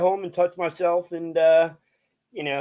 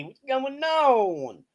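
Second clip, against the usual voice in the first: ordinary talk, nothing marked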